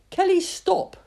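high, loud call across the ruins. Acted. Trainer calls his tired dog to stop, loud call. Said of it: "called Simon".

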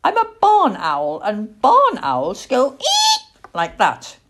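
high, loud indignation with a screech; very cross young owl. Acted. Owl chick showing the girl his call, very cross. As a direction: protest crossly, screeching the "eek". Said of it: "getting very cross".

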